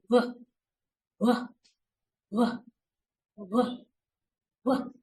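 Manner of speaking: w with lips rounded as if blowing a bubble; w voiced from deep in the throat, lips rounded; lips pursed. Shown as a demonstration. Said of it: "round your lips like you're going to blow a bubble".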